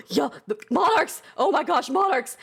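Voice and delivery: high, gasping urgent call; urgent soldiers calling. Acted. Soldiers running to the monarchs, urgent call. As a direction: call out in a rush. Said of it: "some soldiers running past, and they're just like".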